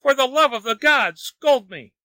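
high-pitched, imploring plea; begging. A narrator's reading. Wang implores laughing Lin for a scolding, begging. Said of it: "begged Wang".

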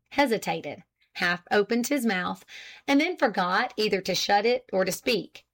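usual speaking voice

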